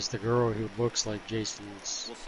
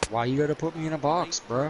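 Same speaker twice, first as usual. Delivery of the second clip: offended protest; 5 semitones higher, 4 dB louder than usual. Retold or imitated. Retold guy offended by assumption.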